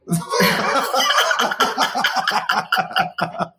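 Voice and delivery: long, airy embarrassed laugh; long unvoiced chuckle; breathy laugh. Real, not acted. Laughing at own weed confession.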